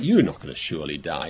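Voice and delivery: a hint of the sly serpent. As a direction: drop low and coax like a tempter. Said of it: "And the serpent said to the woman".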